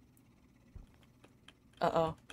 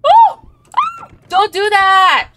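high frightened shriek; 1 scared yelp; 8 semitones higher, 17 dB louder than usual. Real, not acted. Startled by the ghost, shrieking at her friend.

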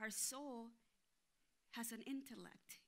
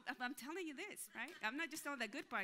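normal talking voice

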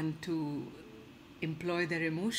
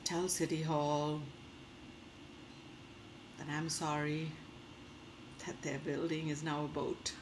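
Godzilla; 4 semitones lower, 8 dB quieter than usual. Acted. Godzilla sending City Hall an apology, softly contrite. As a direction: lower and hush it, pausing long between phrases. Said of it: "taking the opportunity to apologize".

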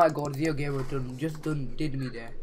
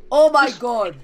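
loud exclamation; 4 semitones higher, 6 dB louder than usual. Real, not acted. Chaotic fight in game.

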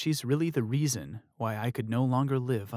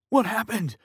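high, loud desperate question; urgent demand; 10 semitones higher, 5 dB louder than usual. Acted. Fiancé demanding answers from the sister, urgent shout. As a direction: press her for an answer. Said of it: "Tyler asked her".

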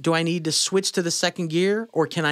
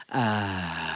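low, airy "uh"; long low breathy note, slightly soft; airy held note; 8 semitones lower, 5 dB quieter than usual. Shown as a demonstration. Voice teacher demonstrating airy version of the note, airy held note. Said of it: "That's an airy version of the same note".

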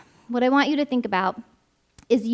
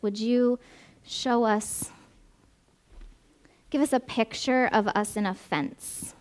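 prayer. Acted. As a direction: pray gently over the room. Leads closing prayer.

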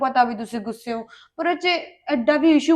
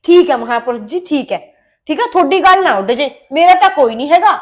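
louder, mocking 'great saint' jab; sarcastic retort; 2 semitones higher, 8 dB louder than usual. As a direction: give in loudly, dripping sarcasm. Wife mocks husband as saint.

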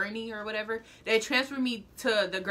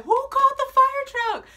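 a shrill, much higher yell of disbelief; high panicked scream; 7 dB louder than usual. Retold or imitated. Injured teen screaming at the arriving fire truck. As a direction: scream it out high and loud, confused and hurt. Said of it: "I'm just screaming to scream".

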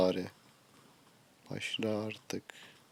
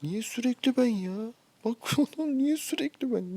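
much higher, incredulous complaint; losing it; 11 semitones higher than usual. Real, not acted. Gamer protests being picked on every time, high exasperated wail. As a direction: plead against unfair luck, pitch shooting up. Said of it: "Vallahi çıldıracağım şimdi ya".

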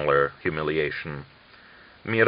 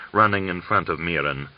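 ordinary delivery

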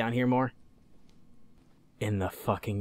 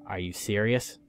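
ordinary talk, nothing marked

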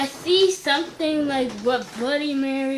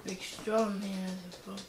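very soft, low reply; scared murmur; 9 semitones lower, 16 dB quieter than usual. Real, not acted. Frightened boy answering his teasing brother, barely audible.

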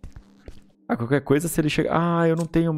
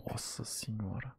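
whispering; 4 semitones lower, 19 dB quieter than usual. Real, not acted. Still hiding beside him.

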